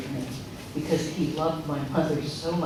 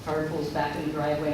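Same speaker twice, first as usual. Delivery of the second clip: ordinary delivery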